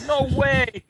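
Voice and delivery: high-pitched cartoon shriek; mock scream. A narrator's reading. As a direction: cry out in exaggerated disbelief. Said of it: "and screamed".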